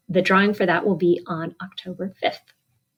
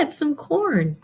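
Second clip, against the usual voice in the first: ordinary delivery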